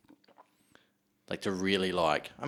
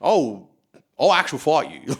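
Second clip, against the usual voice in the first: higher, louder mock bogan threat; mock drunk bogan; 6 semitones higher, 5 dB louder than usual. Imitating the rowdy heckler who threatens to fight him, louder and higher. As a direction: ham up the heckler squaring up. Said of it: "And I was like".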